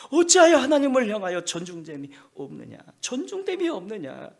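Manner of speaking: rebuking cry. Acted. Preacher voicing rebuke.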